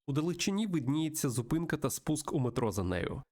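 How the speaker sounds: ordinary delivery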